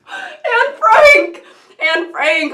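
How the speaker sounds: squealing high with laughter at her slip; laughing correction. Real, not acted. Catching her own slip, a laughing squeal. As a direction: laugh out the right name, loud.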